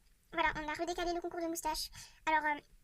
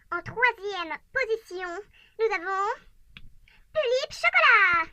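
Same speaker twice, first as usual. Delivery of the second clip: slow announcer reveal; 2 semitones higher, 10 dB louder, much slower than usual. Acted. Revealing third place, slow showman build to a loud name. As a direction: drag it out like an awards host.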